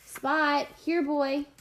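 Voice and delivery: calling. A narrator's reading. Tashawn beckons his dog to the boat, calling out. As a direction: call the dog over.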